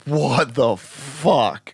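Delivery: very slow, stretched what the fuck; drawn-out disbelief. Retold or imitated. Siblings react to the toddler's claim, slow and stunned. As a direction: drag it out in stunned horror.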